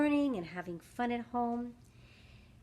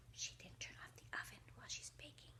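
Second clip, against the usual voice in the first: gasping whisper; 25 dB quieter, faster than usual. Acted. Storyteller whispering to kids about the oven, urgent. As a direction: gasp then hushed fast whisper.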